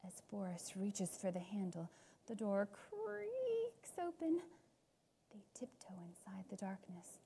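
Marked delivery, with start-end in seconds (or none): 2.3-4.4 slow spooky narration. A narrator's reading. Narrator slows for spooky effect. 5.3-7.2 hushed whispered narration; whispering. A narrator's reading.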